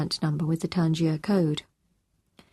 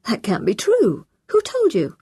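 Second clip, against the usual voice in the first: raised, louder voice leaping up on the question; shocked disbelief; 5 semitones higher, 5 dB louder than usual. A narrator's reading. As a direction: react to shocking news, demanding an answer. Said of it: "Tom gasped".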